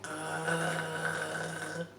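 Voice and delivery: low throaty gargling growl; mock gargled growl; gargle growl. Shown as a demonstration. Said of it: "Gargling is like".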